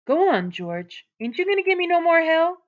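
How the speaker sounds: Lenny urging George on, eager and high. A narrator's reading. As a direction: egg him on, eager. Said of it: "Lenny looked eagerly at him".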